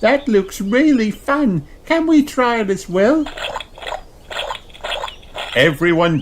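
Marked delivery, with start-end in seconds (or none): 0.0-3.3 put-on very high eager child voice; little kangaroo asking to play; Kylie. A narrator's reading. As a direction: beg to join in. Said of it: "gasps Kylie".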